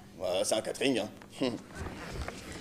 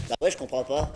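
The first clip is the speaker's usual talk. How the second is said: ordinary delivery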